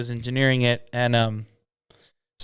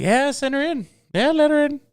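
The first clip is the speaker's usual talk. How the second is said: eager guard's call; 5 dB louder than usual. Retold or imitated. Voicing the security guard.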